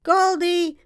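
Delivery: put-on girl calls her missing doll; calling out. A narrator's reading. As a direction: call out across the yard.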